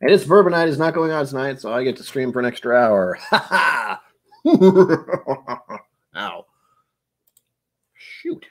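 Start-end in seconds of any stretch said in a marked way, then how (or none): 3.3-5.8 high, hammed-up triumphant cackle; loud mock-villain "ha ha" laugh; gloating laugh. Gloats about extra streaming hour.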